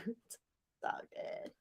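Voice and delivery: unvoiced, breathy whisper. Real, not acted. Whispering an aside to herself after venting to her friend. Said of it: "repeats it whispered".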